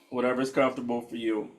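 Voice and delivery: hushed, unhurried guide settling the room; guided meditation. Acted. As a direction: ease them into stillness gently.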